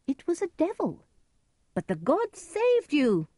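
high, awed stranger's voice; disguised stranger's voice. A narrator's reading. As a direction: exclaim high, pretending to be somebody else. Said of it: "Edgar used a different voice".

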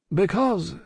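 high, tactful single word; careful reply. A narrator's reading. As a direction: begin the reason delicately. Lorry gives careful reason.

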